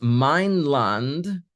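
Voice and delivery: mein Land drawn out very slowly; slow, stressed mein Land. Shown as a demonstration. Translator explaining the pun, slowly stressing mein Land. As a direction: spell out mein Land word by word. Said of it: "some sort of joke here".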